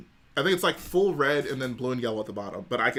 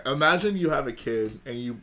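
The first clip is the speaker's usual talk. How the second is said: usual speaking voice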